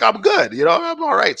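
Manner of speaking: high-pitched mock reassurance; insisting he's fine. Stoned worker tells himself he's fine, high-pitched. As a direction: insist you're okay, pitched well up.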